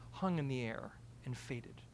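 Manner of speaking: ordinary delivery, nothing marked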